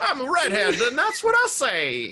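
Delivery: put-on high, laughing old-timey Hollywood voice. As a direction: talk like a 1940s movie star. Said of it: "old-timey voice".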